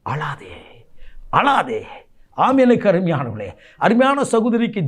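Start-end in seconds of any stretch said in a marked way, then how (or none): 0.1-0.5 soft, low near-whisper; hushed comfort. Acted. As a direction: hush a weeping listener tenderly. God's comfort quoted softly.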